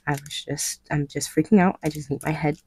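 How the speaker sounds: ordinary delivery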